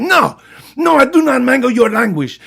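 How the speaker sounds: high, loud squawking retort; shrill argument. Retold or imitated. Teacher shouting back in the knife fight, shrill. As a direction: shriek it back at him. Heated quarrel.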